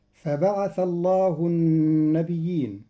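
Quranic chanting of فبعث الله النبيين; recitation. Shown as a demonstration. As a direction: intone it as sacred recitation. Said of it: "قرأ قالون كلمة النبيين".

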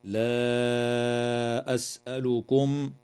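لا أسألكم with the alif of لا drawn out four to five beats; لا أسألكم sung with a stretched madd; long madd. Shown as a demonstration. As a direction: hold the long a of لا before the hamza. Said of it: "وتمد الألف قبل الهمزة".

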